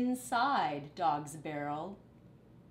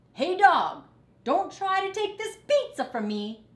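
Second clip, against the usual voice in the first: very high, loud squeaky rat; high rat voice guarding his food; bossy rat voice; 12 semitones higher, 10 dB louder than usual. Acted. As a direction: squeak it out possessively. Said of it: "said Rat".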